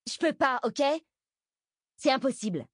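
high-pitched, defensive refusal; upset protest. Acted. Bess protests to Jenna, upset and defensive.

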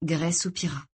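usual speaking voice

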